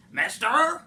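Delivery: high, loud sawdusty cry; the Lorax hails the Onceler with a sawdusty sneeze, high and loud; sneezy Lorax voice. Acted. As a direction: call it out with a sneezy snap. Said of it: "he said with a sawdusty sneeze".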